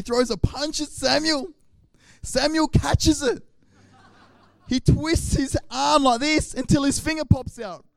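wild mock-action telling; excited play-by-play. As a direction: shout the fight like a cartoon. Acting out the fight.

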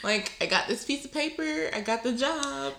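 high, laughing mock panic. To a friend, mock-panicked about graduation, laughing. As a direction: giggle through a pretend graduate's worry.

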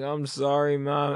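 high, small-voiced retold sorry; meek mock apology. Stoned teen apologising to mom in car, meek mock apology. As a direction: plead like a child in trouble.

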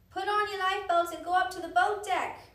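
loud, high shouted order; full-voiced urgent command; shouting. A narrator's reading. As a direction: shout it across a crowded room. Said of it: "the man shouted".

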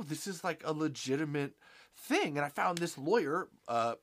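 normal talking voice

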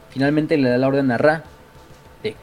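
ordinary talk

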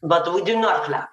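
mock Russian accent, heavy and deadpan. Shown as a demonstration. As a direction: play up a comic Russian accent. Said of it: "And then you wanted Russian".

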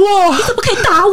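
shrill high wail, hamming up a slapped TV heroine; mock soap heroine. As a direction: whimper it like a melodrama actress clutching her face. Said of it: "那个女主角有没有被打一巴掌的时候".

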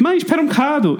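high, laughing aside to mum; quoting herself to her mother, laughing; telling mum to wait. Retold or imitated. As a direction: hurry mum, amused. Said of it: "e disse".

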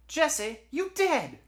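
very high, loud shriek of surprise; shocked. Acted. Dispatcher hears dead Jesse's voice, shrill shock. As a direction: exclaim it in disbelief.

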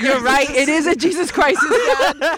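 loud, high comic alarm; hammed-up cry of crisis; mock urgent exclamation. Mock urgent reply to dad.

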